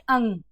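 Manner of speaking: अंग as one nasal syllable "ung"; correct pronunciation demo. Shown as a demonstration. Teacher restating the right sound, correct pronunciation demo. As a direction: let अंग close on a held "ng". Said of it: "इसका सही उच्चारण क्या है".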